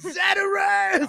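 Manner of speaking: high, loud, drawn-out mock shout; yelled catchphrase. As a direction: holler it triumphantly, stretching the end. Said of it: "that's just what he yells when he's done".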